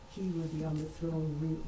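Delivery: normal talking voice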